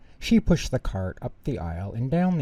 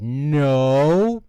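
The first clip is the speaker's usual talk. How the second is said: loud, higher flat refusal; stubborn shout; 5 semitones higher, 11 dB louder than usual. Acted. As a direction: dig in your heels. Argument at cash register.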